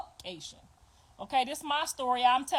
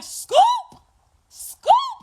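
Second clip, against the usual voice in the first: very high, much louder rising yell; shouted Scoop; 11 dB louder than usual. Retold or imitated. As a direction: yell it like a gang call. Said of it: "She was like".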